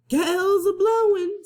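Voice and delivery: high, loud pirate cry into the wind; a pirate calling over the storm; pirate Pinky. Acted. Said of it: "said Pinky".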